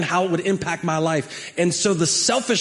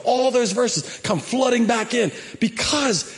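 much higher, shouted conviction; preaching climax; 8 semitones higher than usual. Real, not acted. Pastor telling the church he knows God, shouted high. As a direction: build to a triumphant cry.

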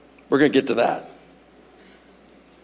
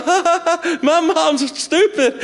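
mock child laughing; 6 semitones higher, slower than usual. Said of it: "And they go".

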